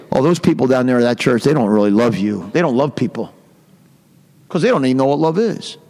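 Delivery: low, falsely pious disapproval, lightly acted; mock pious. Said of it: "they act pious and smile".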